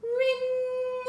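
high sung ring of a fire bell. Acted. Teacher rings the fire bell for the kids, high.

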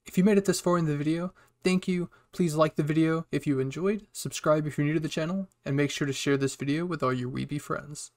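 loud, upbeat channel sign-off; punchy, projected host voice; video outro. Real, not acted. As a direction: wrap up the episode with big energy.

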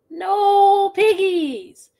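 high, slow singsong duck voice; drawn-out high no from the duck; stubborn duck, slow and high; duck's reply. Acted. Duck refuses the witch.

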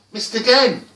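high, loud cry of frustration; loud, exasperated exclamation. A narrator's reading. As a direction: burst out at yet another failure. Second failed attempt, rising frustration.

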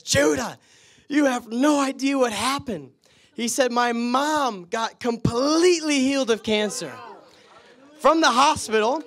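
put-on high, loud, overjoyed girl calling out; excited shout. Girl runs up to Judah in Target, shouting with joy. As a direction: burst out with joyful news.

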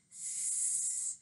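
a long unvoiced snake hiss. Teacher making the snake's hiss for her class, hissing.

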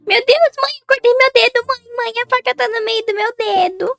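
high, loud hammed-up wailing; fake injury panic. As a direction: wail to mom as if badly cut. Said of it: "vou tentar me fingir que eu". (desespero fingido, agudo e gritado)

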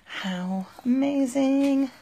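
high, loud, drawn-out delight; thrilled gasp. Real, not acted. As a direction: stretch it out in awe. Excited unboxing of notebook.

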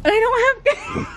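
high laughing alarm; panicked laugh. Real, not acted. Telling her girlfriend they're locked out, high laughing panic.

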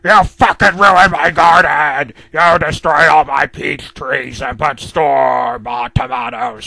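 loud, raging letter read in a put-on voice; enraged villain yelling threats; furious rant. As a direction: scream it at the ones who ruined the garden. Mister Grumpy's furious letter voiced.

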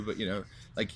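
ordinary talk

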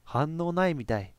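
usual speaking voice

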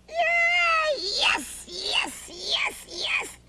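squealing high yay and yeses; excited cheer. Acted. Danny finds his teddy bear home, high excited cheering. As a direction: cheer like a thrilled little boy.

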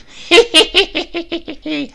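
high, loud crone's cackle; witch cackle. Acted. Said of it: "cackling and spitting".

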